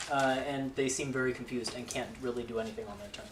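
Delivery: normal talking voice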